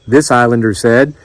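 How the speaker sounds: ordinary delivery, nothing marked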